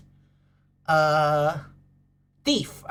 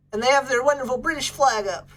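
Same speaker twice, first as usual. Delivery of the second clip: ordinary talk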